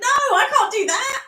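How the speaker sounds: loud, squeaky refusal; high comic objection; high-pitched protest. Real, not acted. Mock protest at being volunteered.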